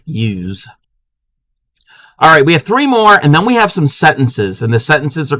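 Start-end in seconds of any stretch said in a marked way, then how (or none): 0.0-0.7 YOUS said as "yooz"; Maryland pronunciation. Shown as a demonstration. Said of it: "people in Maryland pronounce it".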